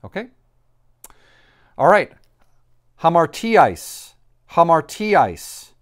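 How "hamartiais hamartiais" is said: Hamartiais drawn out slowly and loudly, higher than usual; modelled pronunciation. Shown as a demonstration. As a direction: model Hamartiais slowly for a class, "ha-mar-TEE-ice". Teacher models the Greek word.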